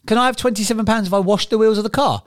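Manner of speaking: son asking. Retold or imitated. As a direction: pipe up like a hopeful kid.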